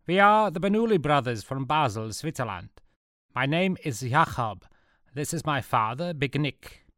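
put-on high-pitched Swiss German accent; formal Swiss German gang introduction; Swiss German Jakob. A narrator's reading.

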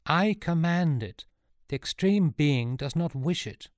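high, forceful order; slow, emphatic start, taking charge; rallying command. A narrator's reading. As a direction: summon authority and command. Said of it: "then rallied".

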